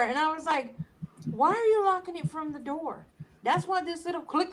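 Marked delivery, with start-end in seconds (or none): 1.3-4.5 higher-pitched retold parental scolding; exasperated quote, pitched up; mock exasperation. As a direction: question in retold disbelief. Retells scolding her daughter.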